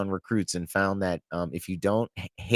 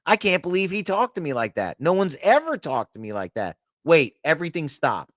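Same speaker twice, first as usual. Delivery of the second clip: higher, whiny imitation of a touchy lieutenant; mock the officer's hurt pride, pitched up; mocking offended officer; 6 semitones higher than usual.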